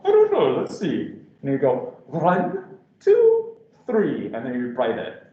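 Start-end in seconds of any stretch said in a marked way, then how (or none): 0.0-1.2 owl voice. Retold or imitated. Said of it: "that's the commercial". 2.1-4.3 counting owl. Retold or imitated. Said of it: "that's the commercial".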